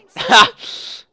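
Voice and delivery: high burst of breathy laughter. Real, not acted. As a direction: laugh out, thrilled. After getting a kill.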